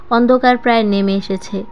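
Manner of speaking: plain reading voice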